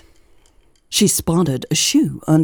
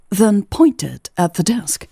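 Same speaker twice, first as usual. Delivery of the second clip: plain reading voice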